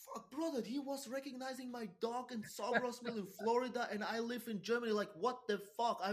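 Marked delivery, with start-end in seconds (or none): none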